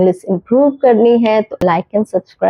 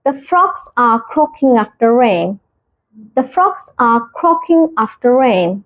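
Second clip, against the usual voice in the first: very slow, each word spaced out; slow clear example; much slower than usual. Shown as a demonstration. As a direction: model the sentence slowly for learners. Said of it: "example sentence read slowly". (धीरे, साफ़ उदाहरण वाक्य)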